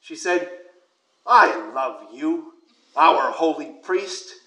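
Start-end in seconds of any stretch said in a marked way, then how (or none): 1.2-4.3 put-on very slow, halting old woman; raspy elderly voice, drawn out slowly. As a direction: imitate a frail grandma, word by word. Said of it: "in her old raspy voice".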